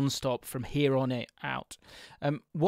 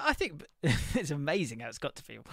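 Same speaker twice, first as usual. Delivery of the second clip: ordinary talk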